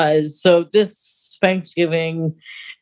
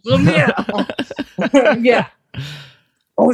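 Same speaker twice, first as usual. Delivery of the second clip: laughing; 6 semitones higher, much slower than usual. Real, not acted. Laughing at the fiancé joke.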